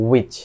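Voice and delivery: "which" said "wich", no h; correct pronunciation. Shown as a demonstration. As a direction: start "which" straight on the w. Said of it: "এটার সঠিক উচ্চারণ হবে".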